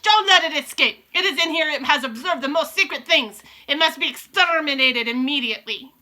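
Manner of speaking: very high, loud screech. Acted. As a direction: screech the hunt order. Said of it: "screeched the Grand High Witch".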